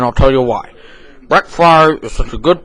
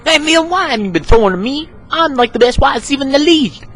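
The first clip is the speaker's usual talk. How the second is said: high, fast, whining complaint; a pushy receiver nagging his quarterback, high and quick; whiny high teammate; 12 semitones higher, faster than usual. Acted. Receiver complaining to Neil about no passes, in a high whine.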